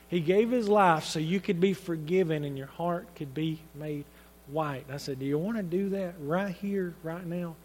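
soft, low fatherly invitation; gentle, hushed. Retold or imitated. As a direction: invite a child quietly, voice dropping away. Father gently leads daughter to pray.

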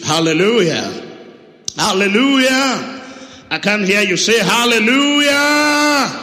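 loud, drawn-out call to the crowd; calling out hallelujah. Real, not acted. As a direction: rouse the congregation to answer. Preacher calls out to congregation.

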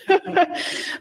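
airy laugh, out of breath; short winded chuckle; breathless laugh. Real, not acted. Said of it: "I'm out of breath".

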